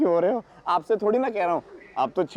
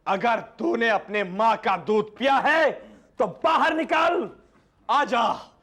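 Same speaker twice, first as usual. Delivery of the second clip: boastful challenge; 3 semitones higher, slower than usual. Retold or imitated. Man bragging how he challenged the wrestler, hammed-up bold call. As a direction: re-enact a grand filmi challenge. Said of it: "और फिर मैंने कहा".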